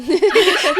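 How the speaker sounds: a high, loud, helpless laugh; loud burst of laughter. Real, not acted. Cracking up at her friend's bad imitation, loud laughter.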